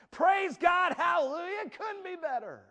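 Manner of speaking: high, loud hallelujah cry; over-the-top pious joy. Acted. Dad greeting someone at church, exaggerated pious joy. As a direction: gush with exaggerated church cheer. Dad's sudden piety at church.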